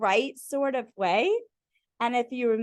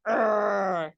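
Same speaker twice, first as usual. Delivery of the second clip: low little growl; a small frustrated growl, lower; little grr; 4 semitones lower than usual. Retold or imitated. Grape showing its leftover frustration, low little growl.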